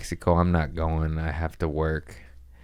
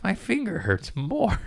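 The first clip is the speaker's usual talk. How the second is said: much higher whiny complaint; mock whine; 12 semitones higher than usual. Himself the next day, whining about the pain. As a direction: whine it like a needy patient.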